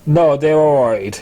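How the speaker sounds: ordinary delivery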